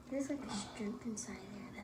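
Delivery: hushed whisper. Real, not acted. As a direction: breathe the words out. Mukbang whispering.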